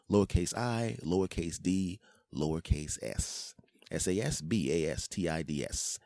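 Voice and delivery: usual speaking voice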